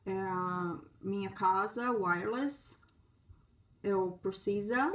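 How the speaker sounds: put-on slow, halting Portuguese with a foreigner's accent; gringo accent. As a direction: grope for each word like a gringo new to Portuguese. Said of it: "Um gringo ... vai falar assim pro vendedor".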